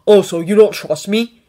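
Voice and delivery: loud, affronted challenge; indignant comeback; rounding on someone. A narrator's reading. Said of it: "Harry rounded on him".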